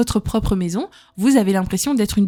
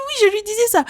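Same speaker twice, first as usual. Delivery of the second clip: high, cheeky confirmation; wife to mother-in-law, high and cheeky; proud reply; 11 semitones higher than usual. Retold or imitated. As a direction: own it cheekily. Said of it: "J'ai dit".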